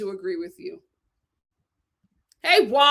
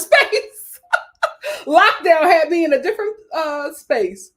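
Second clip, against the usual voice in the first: laughing; 6 semitones higher, 9 dB louder than usual. Real, not acted. Laughs at herself.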